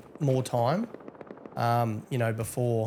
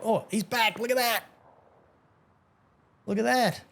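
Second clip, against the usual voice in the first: much higher, sing-song gotcha; delighted surprise; 8 semitones higher than usual. Real, not acted. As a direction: pounce on it like you called it. Banned player reappears.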